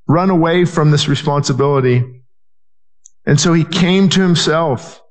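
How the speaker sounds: ordinary talk, nothing marked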